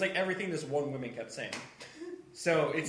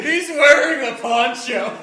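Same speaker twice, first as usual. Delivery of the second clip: higher, louder and slow, drawn-out disbelief; incredulous; 10 semitones higher, 15 dB louder, slower than usual. Real, not acted. Reacting to the shaman's poncho, slow, loud, laughing disbelief. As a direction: spell it out like it's the most absurd thing ever.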